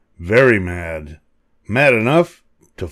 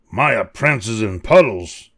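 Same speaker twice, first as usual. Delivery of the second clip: ordinary delivery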